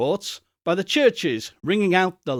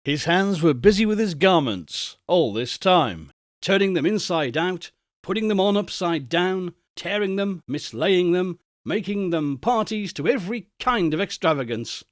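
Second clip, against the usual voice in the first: plain reading voice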